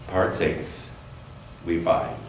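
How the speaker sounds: ordinary talk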